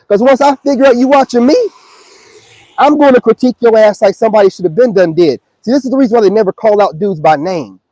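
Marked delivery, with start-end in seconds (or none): none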